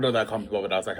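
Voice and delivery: usual speaking voice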